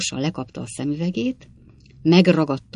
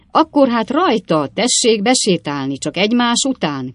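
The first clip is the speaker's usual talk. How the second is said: higher, louder cheerful call to come in; 5 semitones higher, 5 dB louder than usual. A narrator's reading. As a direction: Sam heartily summons the visitors. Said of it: "kiáltott fel Sam". (vidám, hangos behívás)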